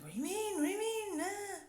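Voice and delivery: confused guest imitated. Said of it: "He's like".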